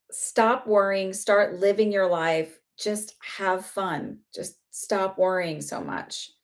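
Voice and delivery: slow, spaced-out plea to ease up; slow retold urging. Spirit tells a worrier to enjoy life, slow urging. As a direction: relay her advice slowly, phrase by phrase. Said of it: "And she said".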